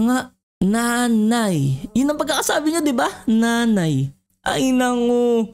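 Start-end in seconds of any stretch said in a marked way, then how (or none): none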